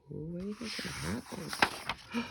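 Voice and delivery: soft, teasing suspense; hushed suspense. Real, not acted. Parent building suspense for the child, hushed. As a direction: lean in and tease the listener.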